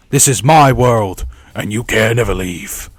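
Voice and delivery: put-on slow, drawn-out villain accent; Freddy accent. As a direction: put on a menacing character accent, drawing it out. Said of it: "put on that accent for Freddy".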